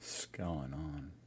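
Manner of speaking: very quiet, tentative practice; hushed, slow try at the phrase. Shown as a demonstration. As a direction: mutter it to yourself, testing the sound. Trying out the phrase under breath.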